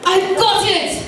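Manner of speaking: high, eager eureka; excited exclaim. A narrator's reading. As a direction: burst out with delight. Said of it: "and he exclaimed".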